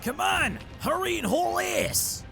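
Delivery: high, urgent yell from the ship; urgent shout. Acted. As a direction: call the stragglers aboard at full pitch. Goblin calls the fleeing group aboard.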